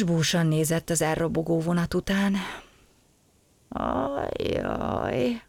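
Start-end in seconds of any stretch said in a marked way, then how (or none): 3.7-5.4 sighing. A narrator's reading. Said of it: "sóhajtozott".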